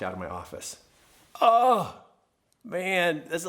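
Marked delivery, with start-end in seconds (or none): 1.4-1.9 loud, high exclaimed "oh"; pained "oh". Real, not acted. Husband recalling hurting his wife, pained loud "oh". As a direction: let out a sudden, regretful "oh".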